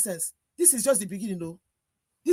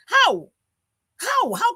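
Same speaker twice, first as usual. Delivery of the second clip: sharp, high and loud angry How; outraged cry; 12 semitones higher, 7 dB louder, much slower than usual. Real, not acted. Panelist erupting at the lawmaker's plan, angry shout. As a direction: cry out in disbelief. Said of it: "I am so pissed off".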